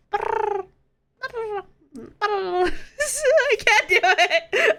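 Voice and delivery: high trilled brr through laughter; giggling while trying to purr; laughing purr attempt. Real, not acted. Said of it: "Try purring?".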